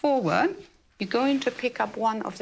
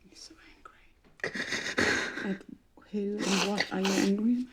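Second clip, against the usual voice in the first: whispered; 5 dB quieter than usual. Real, not acted. Facilitator mirrors grief softly.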